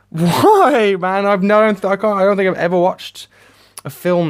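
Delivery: loud, high-pitched despairing complaint; exasperated wail. Real, not acted. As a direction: cry out why, at your wits' end. Wailing in despair at film.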